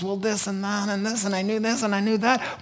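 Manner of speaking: mock excuse-making. People before the living God, reeling off mock excuses. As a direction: imitate someone babbling lame excuses. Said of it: "they give their excuses".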